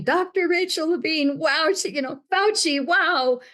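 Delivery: high put-on gushing voice; mock praise. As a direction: lampoon the senators' fawning. Mocking the Senate's praise.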